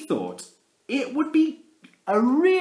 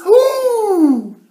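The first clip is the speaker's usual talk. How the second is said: very high, loud ooh; delighted squeak; 11 dB louder than usual. Acted. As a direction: squeal with wonder. Mister Swiss delight.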